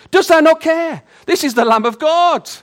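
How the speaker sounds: loud, high, indignant cry; Martha's outburst. Acted. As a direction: fling the complaint at Jesus. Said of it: "Once those words left her mouth".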